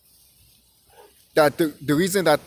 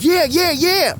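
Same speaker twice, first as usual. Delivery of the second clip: imitating a higher, excited yeah yeah yeah; mock eager agreeing; 10 semitones higher, 3 dB louder, slower than usual. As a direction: mimic relatives getting all fired up. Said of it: "they be like".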